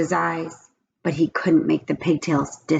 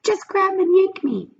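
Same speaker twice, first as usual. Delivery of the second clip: pigtail voice; 13 semitones higher than usual. A narrator's reading. The pigtail coaxes Paul in a high little voice. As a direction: coax him like a tiny tempter. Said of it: "said the pigtail".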